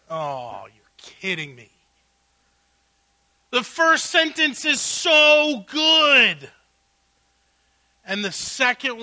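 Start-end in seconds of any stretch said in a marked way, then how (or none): 0.1-1.7 wry disbelief. Real, not acted. Pastor's aside after the confession. 3.5-6.5 delighted praise. Real, not acted. Pastor praises the first sentence.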